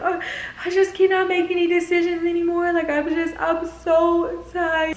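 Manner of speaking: high, strained voice of a worn-out crier; whiny crying voice. Retold or imitated. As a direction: whimper it out, tired and tearful. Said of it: "So I'm sitting here crying".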